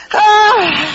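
very high loud wailing gasp; hammed-up relief wail; mock cry of relief. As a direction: let out a huge freed sigh-cry. Workers gasp freedom leaving work.

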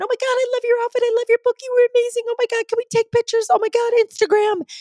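very high, fast, loud airhead voice; mock the fake gushing fans; mimicking the girls gushing, now shallow; mocking valley girls. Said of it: "two very familiar voices".

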